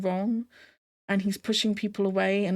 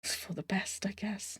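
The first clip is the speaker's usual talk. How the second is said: soft resigned whisper; 10 dB quieter than usual. Real, not acted. Shrugging off the breakup to her viewers in a whisper. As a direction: breathe it out, barely there, giving up. Resigned aside about the couple.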